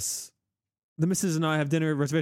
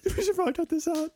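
laughing; 13 semitones higher than usual. Real, not acted. Laughing at joke.

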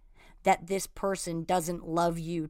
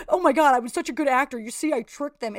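mock smug gloating; 5 semitones higher, 6 dB louder than usual. Mocks own past self.

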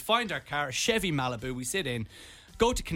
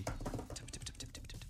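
quick, barely audible tip-tip-tip; voiceless little keyboard taps; imitated typing; 15 dB quieter than usual. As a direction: mimic typing under your breath. Imitating the clerk tapping keys.

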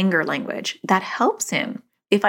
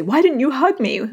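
put-on a higher, lightly whiny child's voice; a hint of a hurt little girl; daughter's complaint; 7 semitones higher, 3 dB louder than usual. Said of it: "later she'll say".

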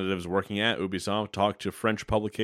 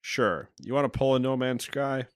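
ordinary talk

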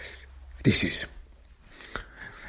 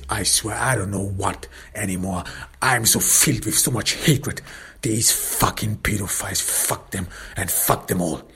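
normal talking voice

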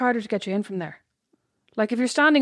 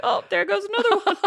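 higher voice breaking into laughter; laughing mock commentary; 11 semitones higher than usual. Joking to co-host about being overtaken, laughing. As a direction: laugh your way through the quote.

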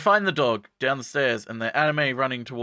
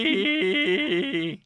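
high, drawn-out squeal; mock delight; 7 semitones higher than usual. Retelling a character savouring food, mock delight. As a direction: stretch the final vowel up high.

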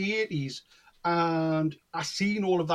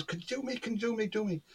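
eager pestering; 4 semitones higher, 7 dB quieter than usual. Retold or imitated. Eager girl pestering him, retold.

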